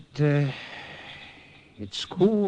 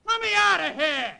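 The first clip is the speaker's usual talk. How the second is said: high, indignant yelling; outraged prisoner shouting; shouting from jail. Acted. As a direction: yell through the bars to be let out. Jailed man yells for release.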